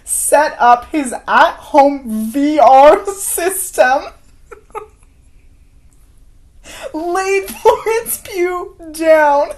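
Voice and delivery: words pushed out high through helpless laughter, slowly; barely getting it out for laughing. Real, not acted. Cracking up while retelling.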